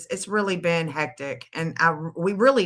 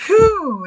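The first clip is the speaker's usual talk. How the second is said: whew; 13 semitones higher, 9 dB louder than usual. Real, not acted. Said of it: "exhale after praying".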